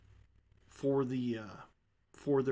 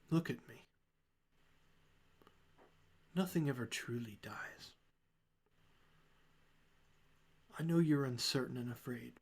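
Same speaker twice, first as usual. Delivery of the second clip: soft consoling; 4 dB quieter than usual. Acted. Symphona consoles Hector up close.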